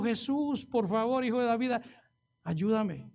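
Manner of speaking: ordinary talk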